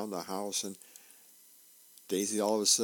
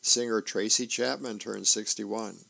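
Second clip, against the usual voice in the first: ordinary delivery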